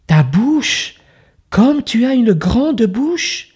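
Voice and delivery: put-on a sweet child begging politely; little girl voice. Girl questions grandmother.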